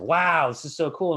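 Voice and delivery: high, loud mock excitement. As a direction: play up the thrill of a cool scene. Imitating players' excited reaction.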